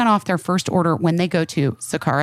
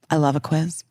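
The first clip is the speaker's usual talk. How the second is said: usual speaking voice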